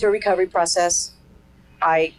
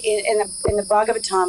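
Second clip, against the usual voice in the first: usual speaking voice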